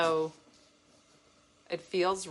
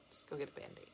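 whispering; 4 semitones lower, 19 dB quieter, faster than usual. Pretend. Whispered nurse reply.

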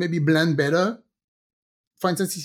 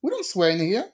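higher-pitched retold protest; mock indignant denial; 4 semitones higher than usual. Parent answering daughter's swear jar request, mock indignant. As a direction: play the innocent parent.

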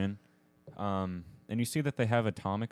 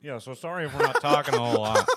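laughing; 5 semitones higher, 8 dB louder than usual. Real, not acted. Laughing through hot wings.

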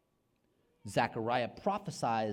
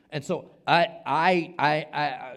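loud stammer; 5 semitones higher, 12 dB louder, slower than usual. Real, not acted.

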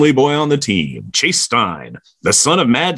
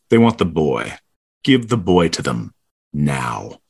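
low, deliberate ultimatum; retold demand; 8 semitones lower than usual. Narrator relaying the team's demand, low and deliberate. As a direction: lay it down low, pausing before the last word.

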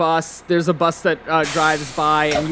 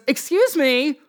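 higher-pitched indignant homeowner; higher voice of an affronted woman; 7 semitones higher than usual. Acted. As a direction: confront trespassers in a raised pitch. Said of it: "this woman comes out".

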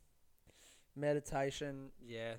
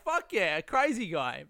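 excited cheer; 4 semitones higher, 4 dB louder than usual. Retold or imitated. Customers cheering the crazy owner.

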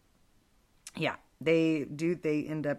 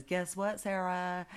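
higher, softly teasing call; a hint of a sly tempter, pitched up; lightly voiced Satan; 4 semitones higher, 4 dB quieter, slower than usual. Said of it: "he goes as an old man".

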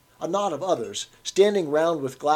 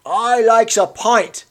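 slow, higher, louder insistence; stubborn old Cockney; 9 semitones higher, 10 dB louder, much slower than usual. A narrator's reading. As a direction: dig his heels in. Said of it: "persisted the old man".

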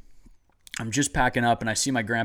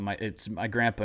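ordinary talk

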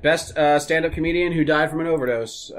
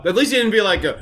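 usual speaking voice

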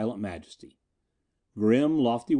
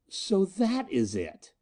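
higher, slow, bitter realisation; venomous; 7 semitones higher, slower than usual. Acted. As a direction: spit it with jealous venom. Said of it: "A venomous flashing look".

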